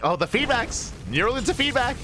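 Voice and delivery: excited shout. Real, not acted. Caster hyped by neural into feedback, high excited call. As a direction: voice leaps high on the combo. Caster reacting to big spell combo.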